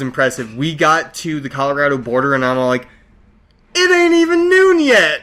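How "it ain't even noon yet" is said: high-pitched, crowing boast; mock triumph. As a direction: crow it way up high, exaggerated. Acting out triumph at the border.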